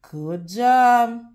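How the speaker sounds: ordinary delivery, nothing marked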